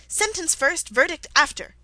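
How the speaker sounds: high, forceful royal command; imperious demand. A narrator's reading. Queen demanding her order of trial, imperious and loud. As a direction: lay down the law loudly.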